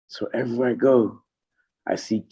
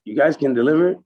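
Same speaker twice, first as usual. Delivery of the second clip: raised voice, loose deal terms; Kenny laying out the deal, pitched up; imitating Kenny; 6 semitones higher, 3 dB louder than usual. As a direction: end the boss's offer up high.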